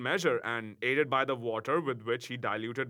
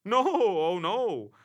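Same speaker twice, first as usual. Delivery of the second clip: slow, loud, alarmed protest; 7 semitones higher, 6 dB louder, much slower than usual. A narrator's reading. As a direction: hastily backpedal in fright. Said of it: "much alarmed".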